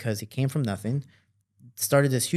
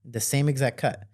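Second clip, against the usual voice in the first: usual speaking voice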